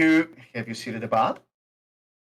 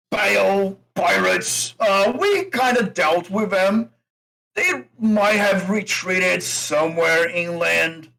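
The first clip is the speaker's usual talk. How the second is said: loud, high, gloating orc captain; boastful orc; 5 semitones higher, 3 dB louder, slower than usual. Acted. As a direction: crow over beaten enemies, rallying the boys. Orc boasts, rallies his crew.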